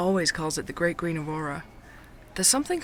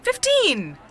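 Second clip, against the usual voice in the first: high, loud cry of protest; indignant outburst; 12 semitones higher, 8 dB louder than usual. Acted. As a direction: blurt it out, offended and much louder. Indignant at being guessed eight.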